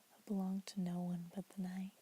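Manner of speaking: ordinary delivery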